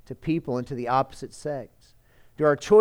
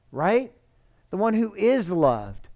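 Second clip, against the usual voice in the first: usual speaking voice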